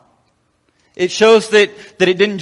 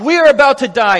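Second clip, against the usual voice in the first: desperate cry; 6 semitones higher, 5 dB louder than usual. Retold or imitated. Disciples desperately waking Jesus.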